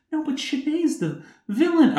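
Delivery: high-pitched defensive quote; mock naive protest. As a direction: squeak it out naively. Quoting her past self.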